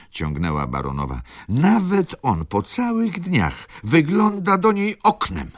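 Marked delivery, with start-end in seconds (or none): 1.5-5.5 very high-pitched wail; dramatic, nearly sobbing. A narrator's reading. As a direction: climb to a tearful, hysterical peak. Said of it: "Dramatyczny głos baronowej". (dramatycznie, na granicy szlochu)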